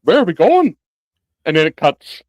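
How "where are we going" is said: high, loud, chipper Pippin quote; mock Pippin squeak; imitating Pippin. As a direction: play the clueless hobbit, bright and high. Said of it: "He goes".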